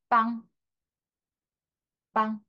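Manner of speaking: 빵 with a nasal an vowel; French nasal an. Shown as a demonstration. Teacher models nasal 빵 for students. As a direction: say 빵 through the nose. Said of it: "같이 그래도 한번 읽어볼게요".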